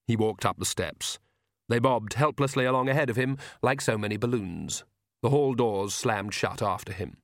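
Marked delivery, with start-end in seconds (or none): none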